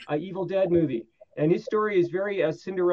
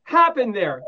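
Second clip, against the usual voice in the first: loud, high-pitched, emphatic public warning; mock dire warning; 7 semitones higher, 6 dB louder than usual. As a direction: proclaim it to the world as hammed-up advice.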